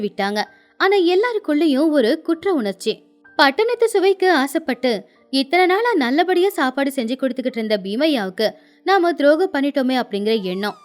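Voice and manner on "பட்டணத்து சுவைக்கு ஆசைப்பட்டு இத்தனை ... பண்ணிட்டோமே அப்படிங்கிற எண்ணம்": usual speaking voice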